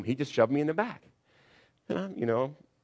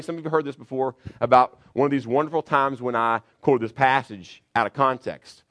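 ordinary talk, nothing marked

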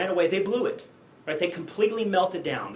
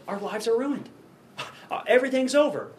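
higher, rising cry of hopelessness; mock despair; 7 semitones higher than usual. Disciples lament that their lives are ruined, higher and despairing. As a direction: voice the disciples giving up.

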